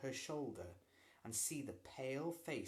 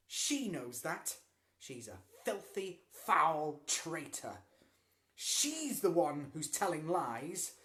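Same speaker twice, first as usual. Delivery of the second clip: glaring; 5 semitones higher, 9 dB louder, slower than usual. A narrator's reading. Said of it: "Lloyd glared at Dinah".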